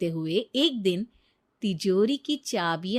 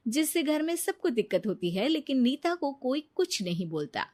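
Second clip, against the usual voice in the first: ordinary talk, nothing marked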